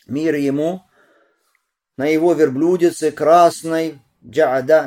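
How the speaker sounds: high, loud, ringing delivery; hadith recitation. A narrator's reading. Recites and translates hadith aloud.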